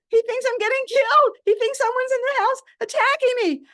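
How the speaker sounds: high, loud alarmed retelling. Retold or imitated. Frightened doctor thinking she's under attack, alarmed. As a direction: play the frightened voice on the phone.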